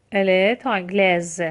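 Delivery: slow تانگلز with a voiced Z ending; teacher's slow model of "تانگلز", z audible; S pronounced as Z. Shown as a demonstration. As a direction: draw out تانگلز and sound the final Z.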